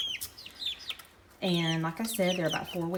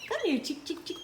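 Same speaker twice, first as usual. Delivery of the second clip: high coaxing call to the chicks; calling chickens; 14 semitones higher, faster than usual. Real, not acted. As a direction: coax the chicks over sweetly.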